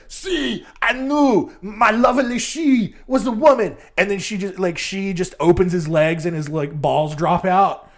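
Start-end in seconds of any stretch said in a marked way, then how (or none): 0.1-3.8 high, hammed-up accented character voice; mock triumphant lover. Bernard insisting his lover is a woman, mock-triumphant. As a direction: declare it as a comic, vindicated Frenchman. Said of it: "Bernard's probably like".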